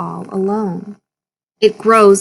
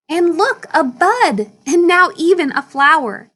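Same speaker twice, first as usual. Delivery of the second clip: higher, wonder-filled showing; storyteller sharing a happy discovery; delighted; 7 semitones higher than usual. Acted. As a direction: point it out with delight. Narrator showing bud and flower.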